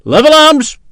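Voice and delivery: loud, high command; shouted order. A narrator's reading. Officer ordering sepoys to aim at the garrison, shout. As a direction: ring the order out over the parade ground.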